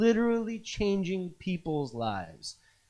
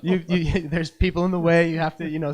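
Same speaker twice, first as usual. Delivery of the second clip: laughing; 8 dB louder than usual. Real, not acted. Laughing, loud.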